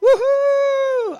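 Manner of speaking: high, loud, drawn-out woohoo; one long, high cheer of triumph; celebratory whoop. Pretend. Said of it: "the prophet would be like".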